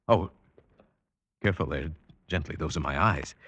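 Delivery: soft, careful warning as fingers near his eyes; gentle caution. Acted. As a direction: quietly ease them off his eyes. Blind man touches his eyes.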